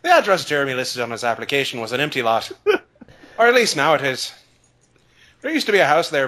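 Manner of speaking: storytelling in a light Irish lilt; a hint of an Irish brogue; Irish accent. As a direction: narrate the grim fire tale as an Irish local. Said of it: "Your Irish accent is so much better".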